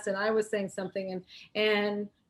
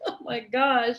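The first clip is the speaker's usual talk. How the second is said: ordinary talk, nothing marked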